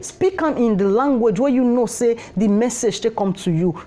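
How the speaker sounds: normal talking voice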